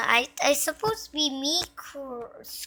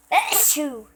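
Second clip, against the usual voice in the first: one sudden loud sneeze; real sneeze mid-sentence; sneezing; 3 semitones higher, 5 dB louder than usual. Real, not acted. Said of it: "because I'm sneezing".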